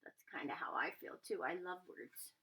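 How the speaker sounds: ordinary delivery, nothing marked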